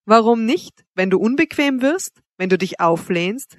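usual speaking voice

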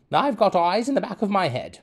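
high loud mock teacher. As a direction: warn like a strict teacher. Mimicking teacher sayings.

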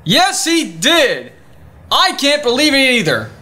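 high-pitched, hammed-up indignation; outraged. Confirming the nerve Charles had, theatrically outraged. Indignant about being disagreed with.

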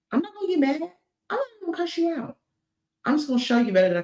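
high-pitched, mock-calm refusal; high, light voice of exaggerated restraint; sing-song restraint. Acts out refusing to retaliate.

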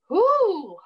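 high, loud woo; whoop. Real, not acted. Singer whooping after telling her Nashville story, high loud woo. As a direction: let out a high, drawn-out whoop. Own whoop between answers.

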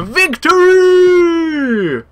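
high, slow triumphant cry; heroic battle cry. Pretend. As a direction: declare it like a charging hero. Heroic declaration mid-game.

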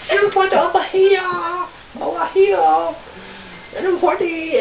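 high excited calling voice; high party call. Pretend.

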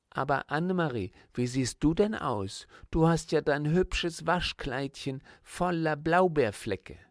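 alarmed teacher calling out, rising to the end; horrified exclamation. Acted. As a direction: cry out in dismay at the stained dress. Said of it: "rief die Lehrerin entsetzt".

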